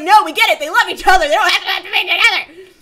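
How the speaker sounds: loud, high mock tirade; worked-up retold protest; exasperated rant. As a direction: shout back at the writers, fed up. Acted rant against the ending.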